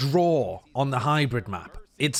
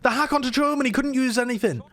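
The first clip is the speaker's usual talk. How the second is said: high, fast caster call; excited call; 9 semitones higher than usual. Real, not acted. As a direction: call the play with hype. Calling a clutch play.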